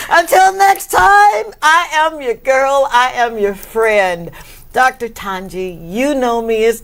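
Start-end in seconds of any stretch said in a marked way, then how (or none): 0.1-2.9 excited sign-off. Real, not acted. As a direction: sing it out big to close the show. Show sign-off to the audience.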